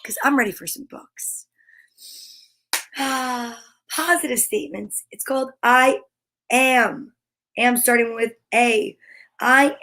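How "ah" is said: normal talking voice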